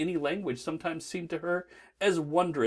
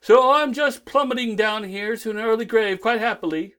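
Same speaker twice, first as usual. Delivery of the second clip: loud; 8 semitones higher, 7 dB louder than usual. Acted. Doctor falling, cheerful.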